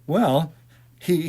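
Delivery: plain reading voice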